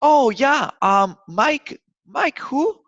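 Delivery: high, loud mock confusion; playing a receptionist who can't place the name; puzzled receptionist. Receptionist unsure who the caller is, mock puzzled and high. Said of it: "Other times they're gonna be like".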